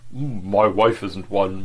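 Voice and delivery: slow sulky mumble. A narrator's reading. Agent defending his wife to his boss, sulky. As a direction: mutter it sulkily. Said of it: "mumbled sulkily".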